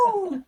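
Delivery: high joyful woo; celebratory whoop. Real, not acted. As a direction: whoop with real joy. Celebrating the finished run.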